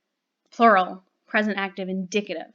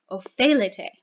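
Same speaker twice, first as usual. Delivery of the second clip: ordinary talk, nothing marked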